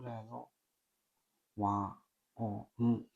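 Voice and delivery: quiet, measured kana recitation, one sound at a time. Shown as a demonstration. Learner practicing the kana chart alone, quiet measured drill. Reciting Japanese syllabary aloud.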